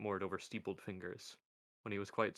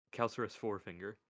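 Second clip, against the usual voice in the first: plain reading voice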